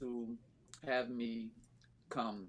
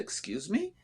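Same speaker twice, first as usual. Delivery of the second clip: retold puzzled query, pitched up with a rising end; taken aback; 9 semitones higher than usual. Coach retelling her reply to a rival's question, taken aback. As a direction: imitate your own surprised double-take.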